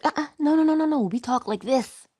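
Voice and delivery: high, rapid-fire string of refusals; playfully insistent, high-pitched scolding; self-correction. Acted. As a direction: cut yourself off and set yourself straight. Acting out correcting your own self-talk.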